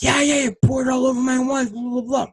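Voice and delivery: high-pitched impression of an angry man; mock yelling. Man accusing the speaker over his wife, mock yelling. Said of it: "he's still yelling and stuff".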